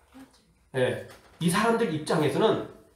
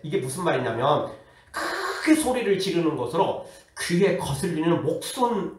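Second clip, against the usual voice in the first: ordinary delivery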